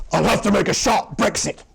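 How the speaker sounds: loud, high shout to everyone; yelled announcement to the whole crowd. Acted. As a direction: shout it out high, timbre pushed hard. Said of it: "turned to everyone and shouted out".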